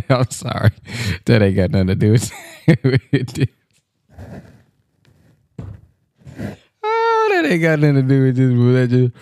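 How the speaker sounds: can't stop laughing while excusing himself; laughing apology. Real, not acted. Caller apologizing for the off-topic video, laughing hard. Laughing at his own tangent.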